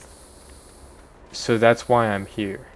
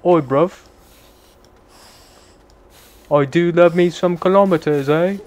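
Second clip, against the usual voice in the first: higher, louder cheeky British voice; mock British accent; 7 semitones higher, 6 dB louder than usual. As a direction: tease like a London lad. Keeps mock British voice joking.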